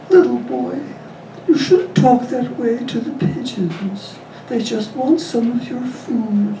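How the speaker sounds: high, slow scolding granny; little old lady. Acted. Old lady scolding the boy, high and slow. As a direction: chide him gently like a prim old lady.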